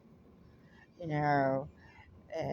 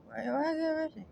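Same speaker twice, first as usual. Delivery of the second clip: much higher, mock amazed; mock-incredulous question; 12 semitones higher than usual. Real, not acted. Storyteller mocking the arrested man, much higher mock disbelief. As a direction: pitch it way up in rhetorical disbelief. Rhetorical punchline of her story.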